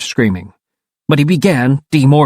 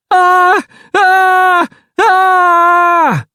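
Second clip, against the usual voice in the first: high held screams, louder; screaming; 4 dB louder, much slower than usual. Acted. David screams in panic as he morphs. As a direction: let out long terrified screams. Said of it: "David screamed a second time".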